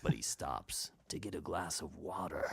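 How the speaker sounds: soft, grave voice-over; documentary narrator. Retold or imitated. Voice actor imitating older narrators on mundane stuff, grave documentary voice. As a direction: lend a trivial act solemn weight. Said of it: "to any sort of documentary".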